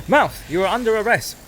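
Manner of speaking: high, loud police officer; cop voice. Pretend. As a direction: arrest your own mouth like a cop. Plays a cop arresting mouth.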